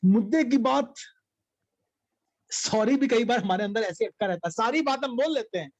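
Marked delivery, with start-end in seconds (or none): 0.0-0.9 mock gasp. Gasping at the key point.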